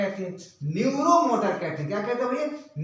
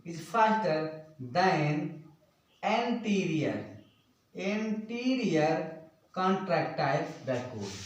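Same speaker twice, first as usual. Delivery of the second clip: word by word, with pauses; drawn-out, segmented delivery; slow dictation; much slower than usual. Real, not acted. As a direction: dictate slowly for note-takers. Dictating notes in English.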